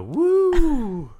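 high, loud, long "woo". Retold or imitated. Imitating the man in the front row, loud wooing. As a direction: imitate a heckler's loud drawn-out whoop. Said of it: "he would go".

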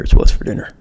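whispered ad tagline; whispered beer slogan; stage whisper. Acted. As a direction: breathe the slogan out unvoiced. Ad tagline again.

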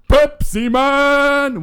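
high, loud, very slow jingle cry; Pepsi Man voice. Shown as a demonstration. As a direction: stretch it like a cheesy ad hero. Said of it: "let me try".

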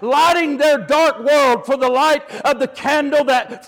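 driving, emphatic roll call of heroes; sermon building in momentum; full-force preaching. Real, not acted. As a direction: preach it in a rising, relentless surge. Preaches church history at full force.

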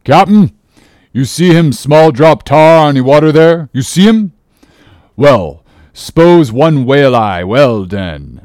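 loud harpooner in broken English; crying out. A narrator's reading. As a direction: call out boldly in broken English. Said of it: "cried out in some such way".

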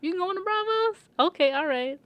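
light up at the news; mock excited. Arya hears the ship goes to Braavos, mock excitement. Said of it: "she's like".

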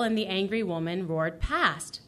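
normal talking voice

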